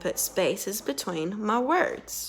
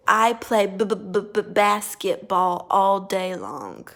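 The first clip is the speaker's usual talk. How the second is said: stutter on purpose. Shown as a demonstration. Therapist faking a stutter for the student to copy. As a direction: fake a stutter on "basketball". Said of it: "sometimes stutter on purpose".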